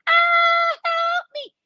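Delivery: very high cry of ah, help me; imitated scream. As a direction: shriek it like a trapped resident. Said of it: "what I felt when I heard the screams".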